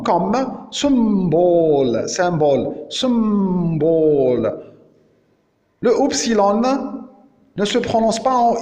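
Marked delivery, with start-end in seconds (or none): 0.7-2.1 slow "symbole" with vowel between i and u, long m; demonstrated pronunciation. Shown as a demonstration. Teacher modelling the Upsilon sound, demonstrated pronunciation. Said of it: "Sa prononciation, elle est entre les deux". 2.9-4.6 slow "symbole" with vowel between i and u, long m; demonstrated pronunciation. Shown as a demonstration. Teacher modelling the Upsilon sound, demonstrated pronunciation. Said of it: "Sa prononciation, elle est entre les deux".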